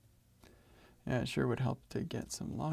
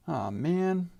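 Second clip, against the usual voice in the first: loud, higher letdown groan; disappointed sigh; 6 semitones higher, 7 dB louder than usual. Real, not acted. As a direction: let the disappointment out. Code change broke things.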